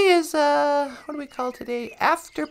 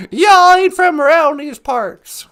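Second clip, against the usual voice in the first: put-on Southern accent; 8 dB louder than usual. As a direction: parody a rural Southern local.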